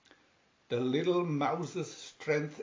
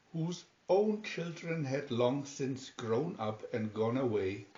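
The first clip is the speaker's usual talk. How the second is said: ordinary delivery, nothing marked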